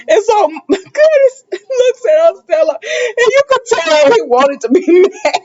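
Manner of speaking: high, squeaky laugh through the words; laughing while talking. Real, not acted. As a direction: tell it while cracking up for real. Cracking up while retelling the scene.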